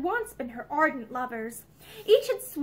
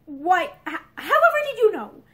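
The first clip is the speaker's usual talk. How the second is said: higher, louder breathless stammer; startled; 4 semitones higher, 7 dB louder than usual. A narrator's reading. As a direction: caught out, gasping the question. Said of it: "Pansy gasped and flushed".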